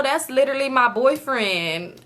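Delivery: usual speaking voice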